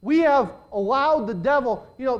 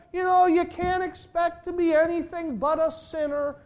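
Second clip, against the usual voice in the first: higher-pitched mocking imitation of a preacher; mock pulpit theology; 8 semitones higher than usual. Preacher mocks sermons that excuse sin, high mock voice. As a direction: lightly parody the excuse sermon. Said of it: "theology that's preached in the pulpits today that says".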